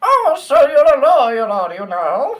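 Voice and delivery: a loud falsetto lawyer-greeter; silly high voice. Acted. Playing a game character, a loud squeaky high voice. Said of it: "That's even worse".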